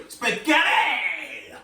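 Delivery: slow, stretched, wiggly word; silly floppy spaghetti voice, lightly done; wobbly spaghetti voice. Shown as a demonstration. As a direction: let the word go floppy like noodles.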